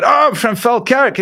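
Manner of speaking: high, loud put-on Scottish; Scottish accent. Newcomer tells the punk his hometown, put-on Scottish accent. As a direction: blurt it in an exaggerated Scottish accent. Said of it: "I replied to him in my Scottish voice".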